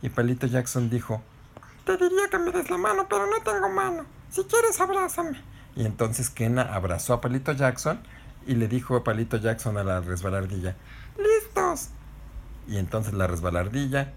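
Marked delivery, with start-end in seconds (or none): 1.9-5.4 very high, squeaky stick-puppet voice; friendly little stick. Acted. Voz de Palito Jackson. 11.2-11.9 very high squeaky call; eager little stick. Acted. Voz de Palito Jackson.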